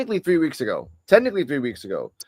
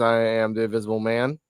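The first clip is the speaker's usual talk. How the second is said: ordinary talk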